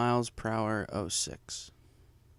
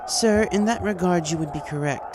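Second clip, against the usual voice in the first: calm princess; 6 semitones higher, 4 dB louder than usual. A narrator's reading. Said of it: "starting the debate off calmly".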